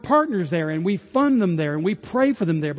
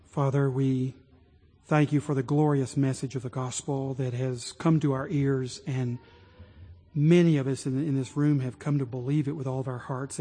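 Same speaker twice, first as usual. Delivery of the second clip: hushed closing prayer; 5 semitones lower than usual. Real, not acted.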